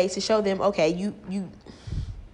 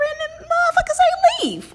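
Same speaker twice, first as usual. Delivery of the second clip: much higher and louder, outraged squeal; outraged shriek; 6 dB louder than usual. Real, not acted. Vlogger telling viewers the wrapper said leaf, outraged shriek. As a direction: shriek it in disbelief.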